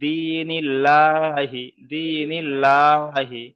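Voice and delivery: দীনিল্লাহি very slow, long "dii" and open "llaa"; thin light L. Shown as a demonstration. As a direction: stretch দীনিল্লাহি with a light, clear L. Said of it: "সেখানে লা বলতে হবে".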